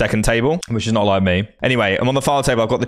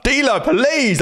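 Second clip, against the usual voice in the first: high, pleading plea to the dealer; mock pleading; 13 semitones higher, much slower than usual. Self-dealing player begs the deck, pleading, high. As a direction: beg the cards like a desperate gambler.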